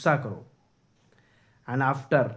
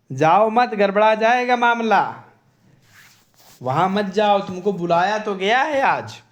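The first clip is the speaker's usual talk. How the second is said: loud, higher, hammed-up wifely plea; pleading wife; 5 semitones higher, 7 dB louder than usual. Caesar's wife begging him not to go, loud mock pleading. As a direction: beg him insistently, as his wife. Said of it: "तब कहती है, कहती है".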